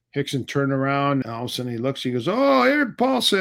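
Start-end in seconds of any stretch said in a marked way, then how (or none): 2.3-3.4 high, louder surprised call of his name; surprised greeting. Retold or imitated. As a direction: recognise him, caught off guard. Coach spots him walking in.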